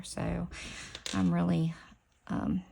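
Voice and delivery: ordinary talk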